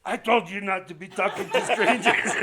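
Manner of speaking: high, loud, laughing scold; imagined wife scolding her husband, laughing; mock scolding. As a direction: play the wife nagging him.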